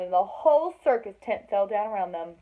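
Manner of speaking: plain reading voice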